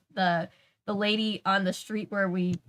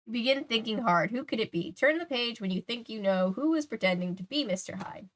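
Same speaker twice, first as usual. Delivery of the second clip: higher, lilting storybook reading; story narration; 3 semitones higher than usual. A narrator's reading. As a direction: read the cliffhanger to the audience. Reads story aloud.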